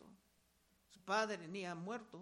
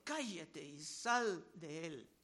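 stern rebuke; 7 semitones lower, slower than usual. Acted. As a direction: lower and slow, a firm command. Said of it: "Jesús le reprendió diciendo". (reprensión severa, más grave y lenta)

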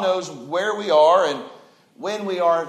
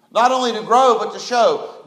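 rising preach; 5 semitones higher, 5 dB louder than usual. Real, not acted. Preacher building up.

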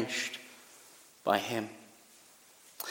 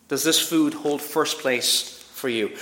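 ordinary delivery

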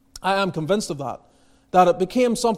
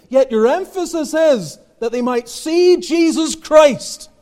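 preaching emphasis; 7 semitones higher, 5 dB louder, slower than usual. Real, not acted. Preaching climax.

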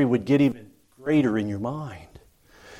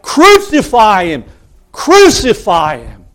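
loud, high chant of a mob; crowd's shout; 8 semitones higher, 11 dB louder than usual. Retold or imitated. Mob demanding Pilate crucify him, shouted. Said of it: "They're crying out".

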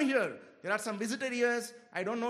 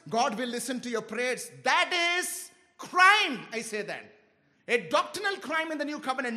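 emphatic preaching; 5 semitones higher, 5 dB louder than usual. Acted. Preacher correcting the church about pastors, emphatic. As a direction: pound each word like a rebuke.

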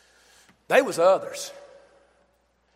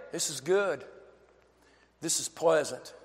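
tender Jesus voice; 5 semitones lower, 7 dB quieter than usual. Acted. Jesus voiced approving woman's act.

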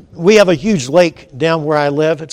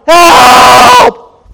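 long, high, full-force shout; yelling for help; 12 semitones higher, 14 dB louder than usual. Acted. Drowning man calling across the lake, yelling. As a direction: holler it out as loud as you can. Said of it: "So I start yelling".

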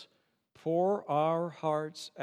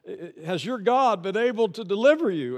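loud, higher call down into the pit; king's call; 6 semitones higher, 9 dB louder than usual. Retold or imitated. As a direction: call out like the anxious king. King yelling at Daniel in story.

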